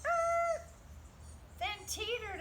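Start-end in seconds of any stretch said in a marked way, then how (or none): none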